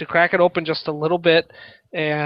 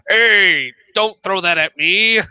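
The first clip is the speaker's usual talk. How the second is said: half dragon voice, raised and loud; draconic voice; 4 semitones higher, 4 dB louder, slower than usual. Acted. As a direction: shout it like a dragon. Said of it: "in the best draconic voice I can muster".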